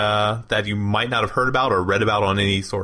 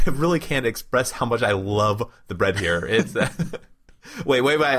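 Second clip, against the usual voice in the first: ordinary delivery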